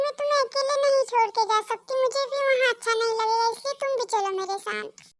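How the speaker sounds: usual speaking voice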